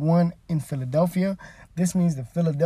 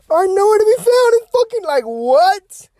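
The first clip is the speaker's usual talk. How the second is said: loud, high, fed-up rant; exasperated outburst; 9 dB louder than usual. Real, not acted. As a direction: blow up in disbelief. Ranting about absent politicians.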